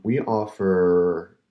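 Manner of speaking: ordinary delivery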